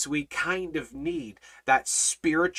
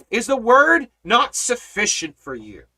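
ordinary talk

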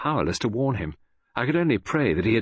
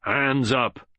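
raised-pitch command, moderately loud; mild shout; 5 semitones higher, 3 dB louder than usual. Acted. Ryland springs the ambush on Poirot, mildly shouted order. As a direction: call out the order sharply. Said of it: "and shouted".

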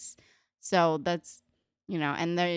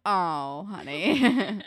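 pitying mock groan; 2 semitones higher, 6 dB louder, much slower than usual. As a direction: react with exaggerated pity for someone naive. Group's pitying reaction, acted out.

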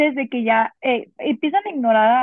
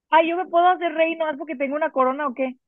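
ordinary talk

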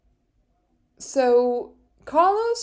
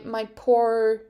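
ordinary talk

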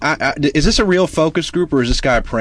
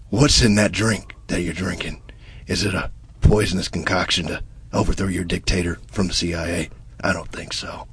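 whisper; 11 semitones lower, 7 dB quieter than usual. Acted. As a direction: lean in and whisper the secret. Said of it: "Give me a beat".

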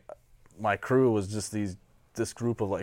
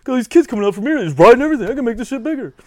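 much higher, louder frantic Sal impression; freaking-out Sal; 13 semitones higher, 10 dB louder, faster than usual. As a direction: squeal it like Sal panicking. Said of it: "Sal said some shit".

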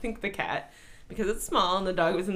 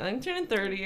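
ordinary delivery

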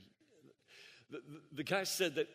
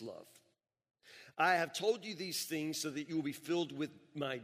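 raised, louder preaching read of scripture; 2 semitones higher, 5 dB louder than usual. Acted. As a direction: proclaim the verses to the congregation. Reads scripture passage aloud.

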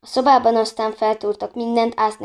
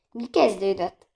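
ordinary delivery

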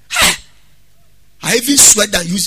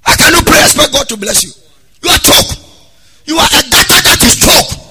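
higher, louder exhortation; preacher berating the unforgiving, louder and higher; preaching at full force; 6 semitones higher, 6 dB louder than usual. Acted. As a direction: hammer the point home.

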